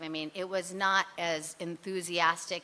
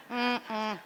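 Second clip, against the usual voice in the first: ordinary delivery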